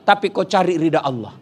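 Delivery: ordinary talk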